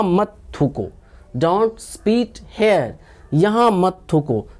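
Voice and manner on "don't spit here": slow, each word set apart; slow drill. Shown as a demonstration. Teacher repeating the translation for learners, slow drill. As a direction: model it slowly for repeating.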